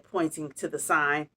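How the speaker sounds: ordinary delivery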